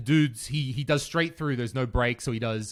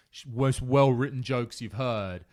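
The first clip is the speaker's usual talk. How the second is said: ordinary delivery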